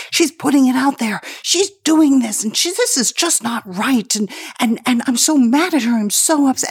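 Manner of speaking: high, fast, upset mother voice; imitating mom, agitated and high. Daughter imitating her upset mom. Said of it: "And she's like".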